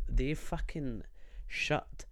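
ordinary talk, nothing marked

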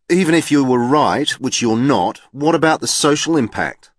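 heated retort. A narrator's reading. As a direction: push back hard, indignant.

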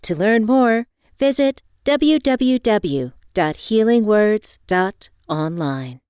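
loud, upbeat sponsor read. Real, not acted. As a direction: sell it like a podcast ad.

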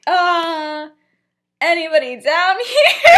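loud, high, hammed-up call. Sirius announcing himself to the common room, calling out. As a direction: call downstairs with mock casualness.

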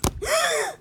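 high, soft dramatic gasp; sharp gasp. Acted. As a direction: gasp in mock shock. Dramatic reaction during story.

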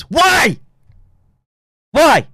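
loud, high-pitched "why"; demanding shout. Real, not acted. Streamer demanding why stupid people were elevated, angry shout. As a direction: fire the question out at full voice. Demanding an answer after his rant.

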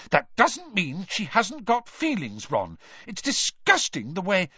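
high-pitched heated retort; indignant. A narrator's reading. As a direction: round on a friend in outrage. Girl rounds on friend, arguing.

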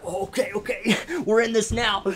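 high laughing rush; laughing panic. Retold or imitated. Hunter laughing after the rifle blast, high and loud. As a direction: laugh through the startle.

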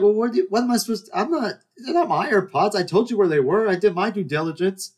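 high, rapid self-defence; exasperated protest. Retold or imitated. Narrator protesting to the staring stranger, exasperated.

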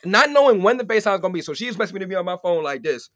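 loud, high-pitched complaint; loud protest. Real, not acted. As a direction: argue your case at full volume. Repeats her rule in protest.

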